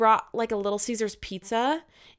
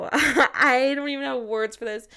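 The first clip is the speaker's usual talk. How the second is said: usual speaking voice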